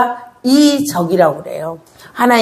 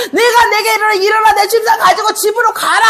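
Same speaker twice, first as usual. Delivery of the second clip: commanding Jesus; 11 semitones higher than usual. Acted. Jesus commands paralytic, preached.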